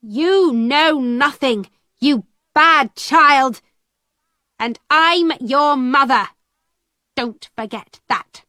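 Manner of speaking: lightly acted mother's rage, fading at the end; angry shouting. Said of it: "shouted at me".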